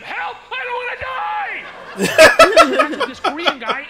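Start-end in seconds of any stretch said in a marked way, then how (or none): 0.0-1.6 high, loud yell for help; panicked scream. Acted. Man fallen among sharks in Mexico, screaming in panic. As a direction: scream for your life, hammed up. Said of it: "I'm screaming my native".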